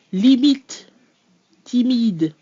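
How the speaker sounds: limite and timide with crisp, precise vowels; French example words. Shown as a demonstration. Teacher reading French example words. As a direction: say both words clearly and evenly. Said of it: "Two example words".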